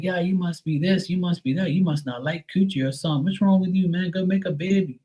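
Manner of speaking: high, nagging mock voice; high mock nagging from people twenty years ago; mocking pushy people. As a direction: hound him like a pushy relative. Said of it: "they were like".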